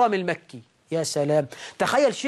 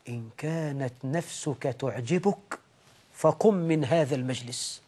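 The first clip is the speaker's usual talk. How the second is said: much softer, lower murmur in his ear; whispered warning; 6 semitones lower, 5 dB quieter than usual. Retold or imitated. Al-Hasan warning Tawus privately, soft murmur. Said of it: "وهمس في أذنه".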